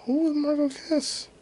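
high, drawn-out baffled question; exasperated squeal. Real, not acted. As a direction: squeak it out in disbelief.

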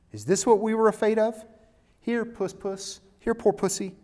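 high, loud, mocking taunt of a cruel creature; sneering mocker. Mocking crowd taunts humbled Aslan.

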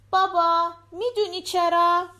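put-on high-pitched little girl chatting to her dad; little daughter. A narrator's reading. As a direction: voice a young daughter, pitched well up. Narrator voices the daughter asking. (صدای زیر دختربچه)

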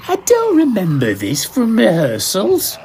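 high, boozy, confused mumble; a drunk actor puzzled on stage; drunken slur. Acted. Drunk actor confused on stage, slurring. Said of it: "he slurred".

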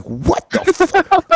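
a long, drawn-out high what the fuck; stunned disbelief. Retold or imitated. Skater asked by an actor if he knows Stevie, stunned disbelief. Shocked at the question about Stevie.

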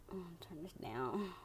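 whispered to herself. Real, not acted. While fixing the music volume, whispering to herself. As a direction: breathe it, no voice. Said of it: "whispered aside".

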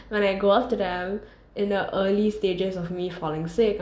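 ordinary delivery

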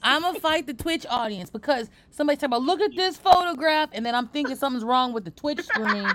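loud, playful threat to chat; hammed-up outrage at chat; mock fighting talk. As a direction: pretend to pick a fight with viewers. Mock threat to Twitch audience.

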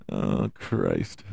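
ordinary talk

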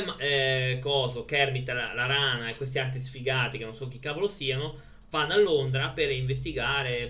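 ordinary delivery

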